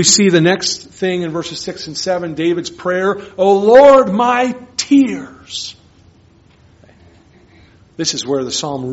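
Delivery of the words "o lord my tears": anguished cry. Acted. Voicing David's anguished prayer.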